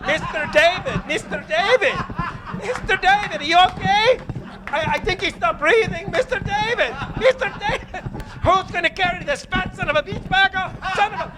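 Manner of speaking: high, frantic wife calling her husband; panicked wife. Acted. Wife panicking over her unconscious husband, high and frantic. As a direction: shriek his name in a panic.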